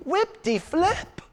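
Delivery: mock indifference. Mocking reply to God.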